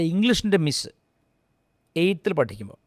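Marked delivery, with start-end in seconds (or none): none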